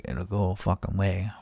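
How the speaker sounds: low, very soft grumbled echo; retold reply, quietly incredulous; muttered disbelief. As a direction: grumble it back under your breath. Sullen echo of support agent's answer.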